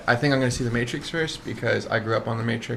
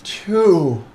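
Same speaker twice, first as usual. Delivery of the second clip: loud, high heroic call; shouting Shazam; 7 semitones higher, 5 dB louder, much slower than usual. Pretend. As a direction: yell it like a superhero transforming. Shouting the hero word.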